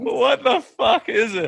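high, slow baffled cry. Real, not acted. Puzzled by a Scottish tweet, high and slow. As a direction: squeal out total confusion.

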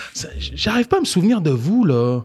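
high, puzzled fake-friendly voice; cheery principal. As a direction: play the confused principal. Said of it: "Il dit". (directeur perplexe, voix aiguë)

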